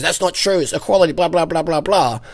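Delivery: high, mocking whine of a critic; mocking feminist voice. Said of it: "like Clem Ford, says".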